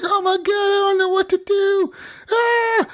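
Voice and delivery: put-on high, squeaky little kid panicking over the Kraken; panicky kid voice. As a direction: squeal it like a scared young child. Kids freaking out at Kraken.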